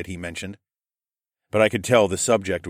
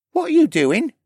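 very high, louder questioning voice; curious Rylan; 7 dB louder than usual. A narrator's reading. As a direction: ask it in a high character voice. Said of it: "Rylan asked".

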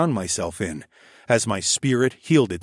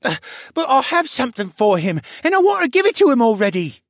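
put-on high, fast and eager boy, louder; a boy bursting to hand over a gift; impatient young boy's voice; 6 dB louder, faster than usual. A narrator's reading. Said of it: "The voice I finally recognized as Ryland's".

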